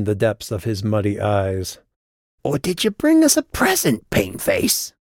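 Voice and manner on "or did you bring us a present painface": very high goblin voice; high, mocking goblin taunt. A narrator's reading. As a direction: jeer at them like a goblin guard.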